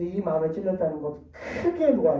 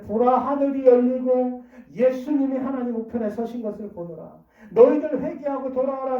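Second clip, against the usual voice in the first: proclaiming; 5 semitones higher, 5 dB louder than usual. Acted. Voicing Stephen's proclamation before his killers.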